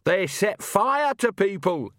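put-on high, slow indignant old woman; Nanny indignant. Nanny telling Agnes about the Omnians, indignant. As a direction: press each word with indignation.

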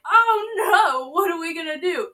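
mock panic. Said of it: "We're all like".

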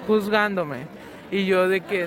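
normal talking voice

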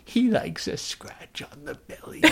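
high, slow, breathless creepy whisper. Retold or imitated. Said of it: "It's so creepy".

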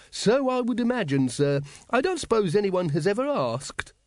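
high-pitched secretary's voice; an obliging underling, pitched well up; deferential secretary. Acted. Secretary answers his lord's question about dragons' bedding, deferential.

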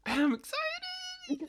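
very high, slow gushing squeal; squealed excitement. Acted. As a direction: overdo the excitement. Exaggerated excitement.

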